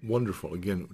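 usual speaking voice

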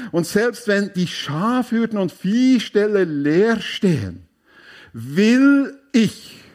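"will ich mich trotzdem" drawn out slow and high; fervent proclamation. Acted. Preacher voices Habakkuk's defiant joy to the congregation, fervent and slow.

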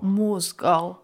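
low, altered Russian-style [name]; russian accent in german. Pretend. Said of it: "mit einem russischen Akzent".

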